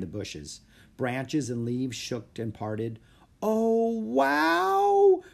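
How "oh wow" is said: breathed. Acted. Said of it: "breathed Annie".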